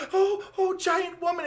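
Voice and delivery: high, loud amazed exclamation; startled. Retold or imitated. Heroine sees the giant woman, high amazed blurt. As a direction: blurt it out in shock.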